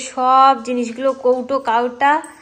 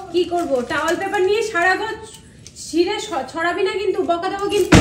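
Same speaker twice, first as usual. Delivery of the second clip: mock warning; 8 semitones higher than usual. Real, not acted. Mother warns daughter off the towel paper, mock scolding. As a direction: warn the little one sternly but kindly.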